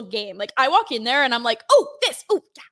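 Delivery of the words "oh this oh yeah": high, loud, laughing gush; excited gushing. Retold or imitated. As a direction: gush over every product. Imitating excited Trader Joe's shopper.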